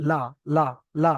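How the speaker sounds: লা short, repeated separately; short vowel drill. Shown as a demonstration. Teacher drilling students on an unstretched, short লা. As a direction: practise লা with no stretch. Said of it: "এটা প্র্যাকটিসে আনতে হবে".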